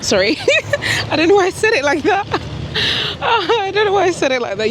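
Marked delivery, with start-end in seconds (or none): 0.4-4.7 giggly and high, through laughter; laughing. Real, not acted. Vlogger laughing at how she just said South London. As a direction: laugh at yourself mid-sentence. Laughing at her own odd accent.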